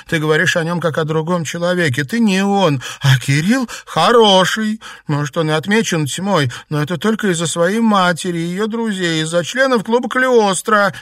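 high, loud, insistent protest; indignant girl's outburst; reproachful. A narrator's reading. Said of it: "с укоризной воскликнула Наташа".

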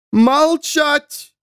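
high, ringing order for silence; booming command. A narrator's reading. Nun silences the crowd in the temple, thunderous. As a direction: ring it out over a whole crowd. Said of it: "Команда прозвучала громогласно".

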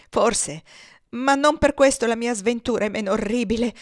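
loud, bitter retort; harsh, irate. A narrator's reading. Grieving father answers Ophelia's pious words, harsh and irate. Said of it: "replicò egli con voce aspra, irraconda".

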